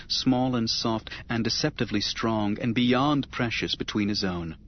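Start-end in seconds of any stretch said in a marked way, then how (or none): none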